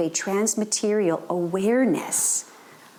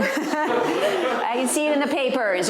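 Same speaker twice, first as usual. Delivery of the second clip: laughing through it, amused; cracking up at his own story; 4 semitones higher, slower than usual. Real, not acted. Speaker joking about the kids' marriage, laughing. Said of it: "laughing at the story".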